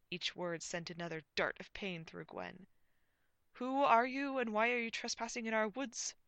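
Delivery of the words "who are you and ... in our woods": loud, raised interrogation; projected, high comic knight voice; challenging knight. A narrator's reading. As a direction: challenge intruders imperiously.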